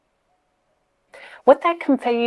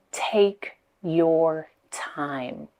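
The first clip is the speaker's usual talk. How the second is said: whispered; 3 semitones lower, much slower than usual. Shown as a demonstration. Coach modelling calm by whispering the third step. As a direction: breathe the words out slowly in a whisper. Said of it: "And third step".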